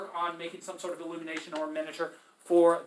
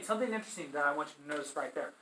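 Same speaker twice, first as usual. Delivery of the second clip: ordinary talk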